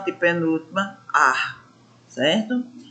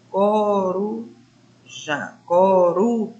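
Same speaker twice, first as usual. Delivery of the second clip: "coruja" drawn out with "ru" lifted; "coruja" slowly demonstrated, stress on "ru"; rising middle syllable; slower than usual. Shown as a demonstration. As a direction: stretch "coru", leaning on "ru". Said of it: "A quem foi que subiu?".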